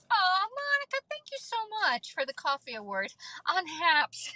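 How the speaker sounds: very high, delighted thank-you; gushing thanks. Real, not acted. Host thanking a viewer for a coffee award, gushing. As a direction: gush with real surprise, voice way up high.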